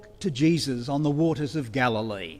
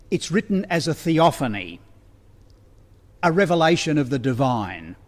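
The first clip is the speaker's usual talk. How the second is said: ordinary talk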